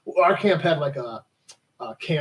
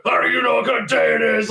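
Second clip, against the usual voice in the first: shouted, higher-pitched comic rebuke; mock gruff sergeant; 6 semitones higher than usual. Sergeant snapping at a cheery young soldier, mock gruff. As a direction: bark it like a movie sergeant.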